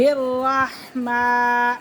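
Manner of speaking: الرحمن with the meem held one alif long; stretched meem. Shown as a demonstration. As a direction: draw out the م in الرحمن for one beat. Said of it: "میم کو ایک الف کے برابر کھینچ کے". (الرحمن میں میم ایک الف کھینچ کر)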